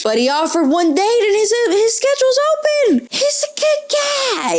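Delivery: absurdly high, exasperated mock advocacy; mock defending. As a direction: voice climbing to a high squeal. Mock defence of the pushy suitor.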